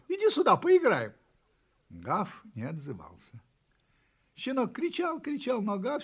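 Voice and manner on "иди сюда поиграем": high, loud invitation to play; calling out. A narrator's reading. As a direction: shout up to a friend, inviting. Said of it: "позвал щенок". (громко зовёт играть)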